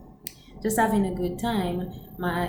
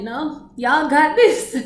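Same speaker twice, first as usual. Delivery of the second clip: slower, louder, higher brush-off; mock coach dodging; 7 semitones higher, 9 dB louder, slower than usual. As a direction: toss it back to them.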